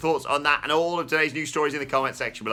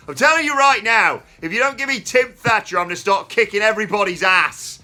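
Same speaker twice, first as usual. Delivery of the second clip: much higher and louder, a shouted all-caps threat; shouting in all caps; 9 semitones higher, 10 dB louder than usual. Shown as a demonstration. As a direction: yell it like a wrestler's angry tweet. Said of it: "I'm just gonna shout it because it's in all caps".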